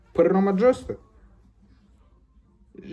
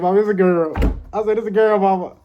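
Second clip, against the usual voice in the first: louder, higher, panicked blurt; panicked confession; 5 semitones higher, 8 dB louder than usual. Retold or imitated. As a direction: spill it out in a panic.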